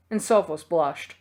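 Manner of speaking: ordinary delivery, nothing marked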